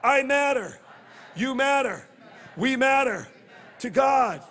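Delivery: shouted call for the crowd; call and response. Shown as a demonstration. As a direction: lead the crowd, loud and strong. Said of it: "Everybody say this with me".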